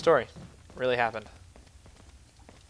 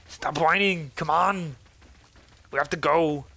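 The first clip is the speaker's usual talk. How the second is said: raised, higher impatient call; exasperated scolding; 4 semitones higher, 4 dB louder, slower than usual. Pretend. Telling Ashley to stop whining, exasperated. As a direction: scold a dawdling companion, louder.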